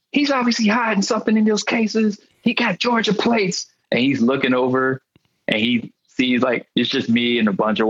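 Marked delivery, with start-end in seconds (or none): 0.1-3.7 high, whiny impression of the young cop; mocking young cop. Young cop complaining to his boss, in a high whiny mock voice. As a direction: squeak it out like a tattling rookie.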